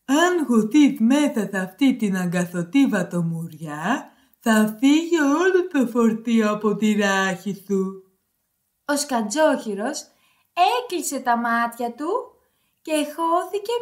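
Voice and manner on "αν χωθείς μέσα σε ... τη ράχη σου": low put-on pig voice; storybook pig. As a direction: drop the pitch into a pig character. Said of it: "είπε το γουρούνι". (χαμηλή φωνή γουρουνιού)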